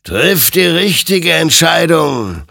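slow, high, loud exhortation; admonishing. A narrator's reading. Creature warns Hector as he cocks the gun, admonishing loudly. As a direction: call out the warning, stretched and forceful. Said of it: "ermahnte ihn sein Gegenüber".

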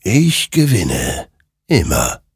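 slow, portentous creature voice; murmuring rider. A narrator's reading. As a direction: space the words out, falling in pitch. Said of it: "murmelte dieser".

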